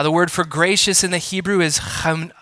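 ordinary talk

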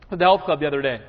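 ordinary talk, nothing marked

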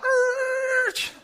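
high shouted screech like tires peeling out; voiced tire screech; imitated tire squeal. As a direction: mimic cars squealing away. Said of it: "they'd squeal out".

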